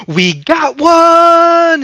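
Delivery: high, loud, long-drawn holler; hammed-up excited call across the firehouse; imitated triumphant yell. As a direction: yell it out, stretching the words. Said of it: "she goes".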